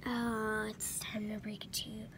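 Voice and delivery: hushed, fast confiding whisper; dramatic confession whispered; mock secret whisper. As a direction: lean in and whisper the big secret. Confession to viewers.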